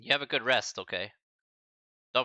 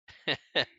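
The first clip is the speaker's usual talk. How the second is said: short laugh; 5 semitones higher, 4 dB louder than usual. Real, not acted. Laughs amid emotional goodbye.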